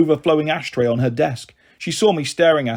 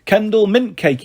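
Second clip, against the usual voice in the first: put-on higher, proud little boy's punchline; child's joke; 6 semitones higher than usual. A narrator's reading. Small boy telling his family joke, proud. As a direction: deliver the pet joke brightly. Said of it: "he said".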